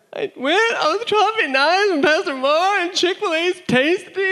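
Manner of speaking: very high, wailing babble; hammed-up tearful blubbering; mock sobbing confession. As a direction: wail it like a crying kid. Retold crying confession to mom.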